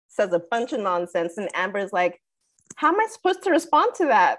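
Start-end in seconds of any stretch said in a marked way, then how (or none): 2.8-4.4 high, quick, baffled protest; mock exasperation. Film debater answering a bunch of nonsense, mock exasperated. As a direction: toss it out fast and pitched up, imitating indignation.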